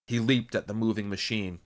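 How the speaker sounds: ordinary delivery, nothing marked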